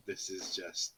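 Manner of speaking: low, subdued grumble; retold disbelief. Gamer retelling his own scare, subdued disbelief. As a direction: say it low and quiet, as if to yourself.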